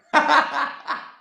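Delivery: short burst of high, loud laughter; real laughter. Real, not acted. Speaker laughs after saying they succeeded without him, real laughter.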